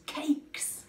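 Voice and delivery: ordinary delivery, nothing marked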